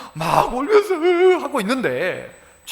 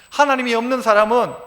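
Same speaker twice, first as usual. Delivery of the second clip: ordinary talk, nothing marked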